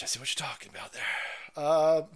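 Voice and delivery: ordinary talk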